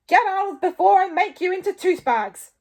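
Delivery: high, booming threat; furious full-volume shout; Stoic yelling. A narrator's reading. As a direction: yell them out of the room. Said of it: "yelled Stoic".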